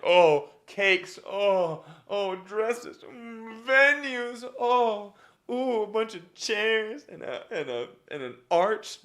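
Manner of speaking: high, gushing squeals over wedding things; mock excitement. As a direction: gush like a giddy guest. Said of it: "it could be excitement".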